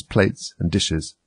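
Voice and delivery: ordinary delivery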